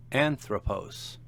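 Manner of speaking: Anthropos said slowly, stressed on AN; stress on first syllable. Shown as a demonstration. Teacher showing an example word, Anthropos slow and stressed on AN. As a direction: draw out Anthropos with the weight on AN. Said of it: "Notice the accent on the antepenult".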